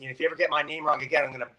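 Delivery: ordinary talk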